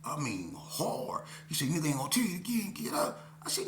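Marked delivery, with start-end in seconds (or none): 1.7-3.2 threatening robber. Retold or imitated. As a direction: snap the last warning at him. Robber threatening at knife point.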